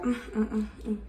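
demonstrated soft eating hum; short soft repeated mms; humming over food. Shown as a demonstration. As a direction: show a quiet, happy hum over good food. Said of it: "you start humming, you be like".

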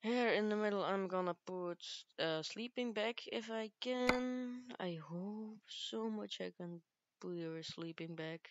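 ordinary talk, nothing marked

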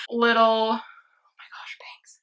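whispered aside to herself; real whisper, slow. Real, not acted. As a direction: breathe it out, no voice. Whispering about her hair.

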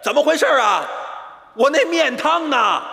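抬高调门，末句拖长; 假装生气地高声催问; 生气质问. Acted. 等急了再次质问服务员. (等急了，高声质问服务员)

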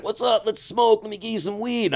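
high-pitched imitation of excited fans; eager greeting. Locals welcoming him when he lands, high eager imitation.

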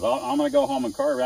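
hammed-up eager student; imitated eager student. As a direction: squeak it out like a nervous eager beginner.

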